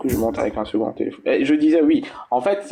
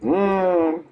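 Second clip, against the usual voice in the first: ordinary talk, nothing marked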